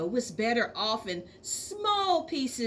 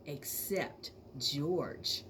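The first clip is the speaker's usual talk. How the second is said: quiet aside; 8 semitones lower, 9 dB quieter, much slower than usual. A narrator's reading. Narrator drops voice for contrast.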